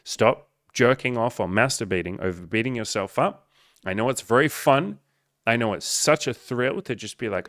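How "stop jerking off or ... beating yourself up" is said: ordinary talk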